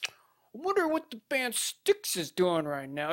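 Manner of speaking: imitating sandwich-shop regular. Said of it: "He's like".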